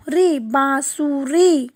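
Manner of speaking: बांसुरी said slowly with nasal बां; slow syllable reading. Shown as a demonstration. Teacher to children, slow nasal word. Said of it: "तो कैसे बोलेंगे?".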